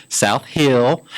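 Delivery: put-on light Southern accent; a touch of a Southern drawl.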